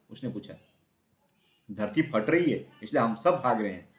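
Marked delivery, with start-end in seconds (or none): none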